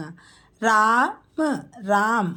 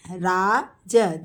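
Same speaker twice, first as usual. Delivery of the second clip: teacher spelling out राज slowly; slow drawn-out model; 3 semitones lower, much slower than usual. Shown as a demonstration. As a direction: stretch each sound of राज slowly. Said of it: "teacher models name slowly".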